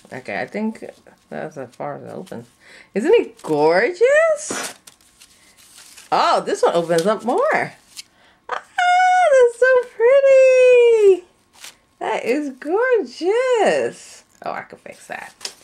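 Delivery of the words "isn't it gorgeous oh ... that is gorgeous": high, loud, slow gush of delight; gushing squeal. Real, not acted. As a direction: squeal over it, almost crying with joy. Fan opens up fully.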